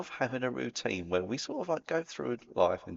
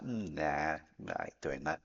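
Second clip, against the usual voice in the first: dismissive brush-off; 7 semitones lower, 6 dB quieter, slower than usual. Retold or imitated. Voicing someone who rejects advice.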